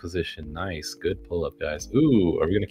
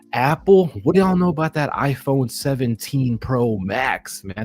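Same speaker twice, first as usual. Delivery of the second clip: high, slow drawn-out hype for Apple; 7 semitones higher, 4 dB louder than usual. Real, not acted. Trader hyping Apple products to viewers. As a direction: sell it like a pitchman.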